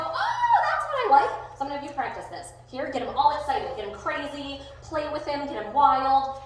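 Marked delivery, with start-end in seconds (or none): 0.0-1.3 very high, loud gush; high-pitched laughing delight; delighted praise. Acted. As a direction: squeal with approval at a dog. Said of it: "And I go".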